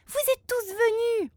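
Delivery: high, delighted surprise; surprised child. A narrator's reading. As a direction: gasp it out, thrilled. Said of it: "children greet surprised". (enfant ravi et surpris)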